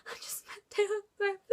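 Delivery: high-pitched tearful wail, re-enacted; mock crying. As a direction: act out sobbing through the words, ending on a big sigh. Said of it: "crying, holding this photo".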